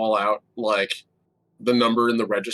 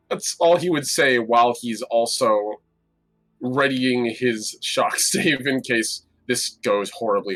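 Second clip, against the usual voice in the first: ordinary delivery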